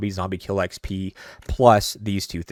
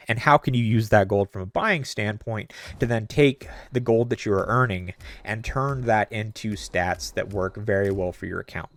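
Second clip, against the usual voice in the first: usual speaking voice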